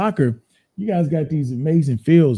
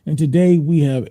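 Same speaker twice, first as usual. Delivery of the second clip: high, loud, upbeat host greeting; show host intro; 4 semitones higher, 4 dB louder than usual. Acted. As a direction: open the show with energy.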